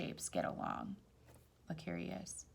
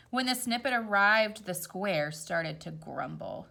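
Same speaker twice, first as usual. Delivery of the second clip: gentle storybook read-aloud; rhyming story voice; reading to children; 5 semitones higher, 3 dB louder than usual. A narrator's reading. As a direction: read it like a picture book at bedtime. Reads picture book aloud.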